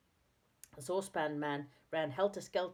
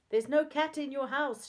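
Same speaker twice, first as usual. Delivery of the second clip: raised, higher voice; shouting; 9 semitones higher, 7 dB louder than usual. A narrator's reading. Moonface to the old man who mishears, shouting. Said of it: "shouted Moonface".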